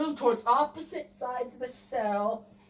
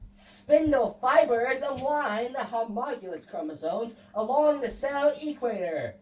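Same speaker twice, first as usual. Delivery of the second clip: normal talking voice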